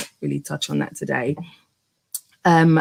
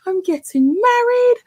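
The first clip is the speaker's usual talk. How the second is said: high, loud, giddy cry of joy; squealing with delight at the proposal; overjoyed; 4 dB louder, slower than usual. Retold or imitated. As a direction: shout it like the best news ever. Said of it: "I'm so excited".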